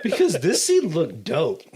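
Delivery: excited laugh through the words; laughing excitedly. Real, not acted. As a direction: gush about the scene, laughing. Excited praise while laughing.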